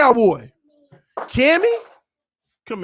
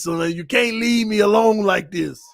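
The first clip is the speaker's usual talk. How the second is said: ordinary delivery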